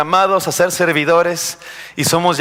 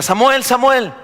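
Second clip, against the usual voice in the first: higher, louder call of God from afar; 10 semitones higher, 3 dB louder, slower than usual. Acted. Preacher voicing God calling young Samuel, calling out. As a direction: call out the name as if from another room.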